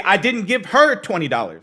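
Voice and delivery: single out "her" with a strong accent; stress on HER. Shown as a demonstration. Showing viewers somebody else got it, stress on "her". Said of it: "depending on how I emphasize it".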